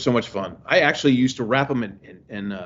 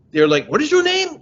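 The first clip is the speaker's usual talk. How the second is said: demanding question; 13 semitones higher, 6 dB louder than usual. Retold or imitated.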